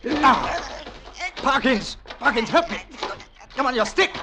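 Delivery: high, loud cry for help; shout. Acted. Husband shouting to his butler for help during a struggle. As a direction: yell out in sudden alarm. Attacked in the garden, calling servant.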